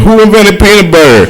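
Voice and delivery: mock kid voice. Kids mocking him.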